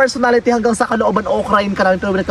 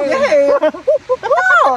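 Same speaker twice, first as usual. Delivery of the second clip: excited cheer; 12 semitones higher than usual. Real, not acted. Cheering back to vlogging.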